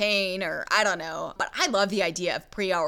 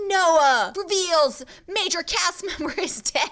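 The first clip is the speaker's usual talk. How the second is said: mock headline drama; 9 semitones higher, 4 dB louder, slower than usual. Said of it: "It was like".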